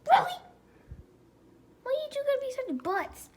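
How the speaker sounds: high, exasperated what the; mock outrage at the dogs, pitched high; exasperated outburst. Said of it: "I'm like".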